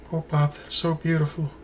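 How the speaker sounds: soft, quiet mock-weepy Lana voice; voicing Lana crying. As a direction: pretend to be Lana, touched and teary, softly. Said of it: "Lana, are you crying?".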